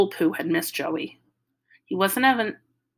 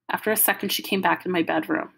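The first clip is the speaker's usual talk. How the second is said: usual speaking voice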